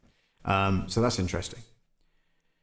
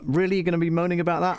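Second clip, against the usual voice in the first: mocking; 8 semitones higher, faster than usual. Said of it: "taking the mickey out of somebody".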